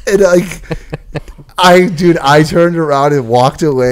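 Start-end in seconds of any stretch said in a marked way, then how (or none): none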